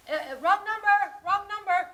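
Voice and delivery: loud, high, spluttered brush-off; hammed-up coughing phone voice; coughing, flustered husband. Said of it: "He would start coughing and say".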